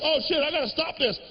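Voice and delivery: put-on teen caught by his mom; panicked excuse. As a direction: blurt it out to stop her talking. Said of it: "you're like".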